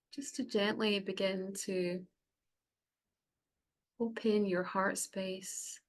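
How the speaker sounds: unhurried meditation voice with long pauses; guided meditation. Acted. Facilitator guiding the group to close their eyes, guided meditation. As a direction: ease them gently inward, phrase by phrase. Leads a cacao ceremony meditation.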